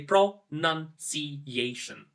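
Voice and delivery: pronunciation split slowly into syllables; slow syllabified pronunciation. Shown as a demonstration. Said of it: "can be divided into different sections".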